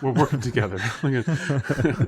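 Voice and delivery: giggling through the line; laughing character voice. Pretend. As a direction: play the happy accomplice, laughing. Joining the bit, laughing.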